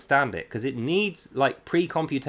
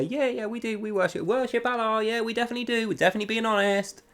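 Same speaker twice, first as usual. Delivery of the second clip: high, quick, eager fake agreement; mock lying reassurance; 7 semitones higher than usual. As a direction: rattle it off like a liar trying to sound sincere. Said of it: "gonna lie to you and say".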